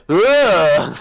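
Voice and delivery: a short loud retold grunt; just grunt it out; mock grunt. Friend recalls Sam's failed impression, grunting. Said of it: "Sam just went".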